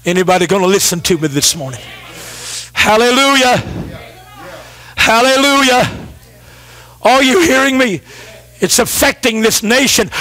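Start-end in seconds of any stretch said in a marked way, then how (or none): none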